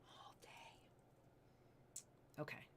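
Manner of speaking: trailing, barely voiced whisper. Real, not acted. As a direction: let it fade to breath. Host toying with stone.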